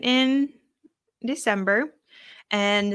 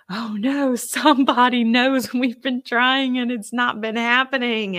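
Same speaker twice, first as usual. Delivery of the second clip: higher, mildly panicked inner voice; mock worry; 4 semitones higher, 3 dB louder than usual. Woman voicing her fear that somebody knows, mock-worried. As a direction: act out an anxious thought with a laugh in it.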